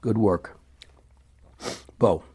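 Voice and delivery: ordinary delivery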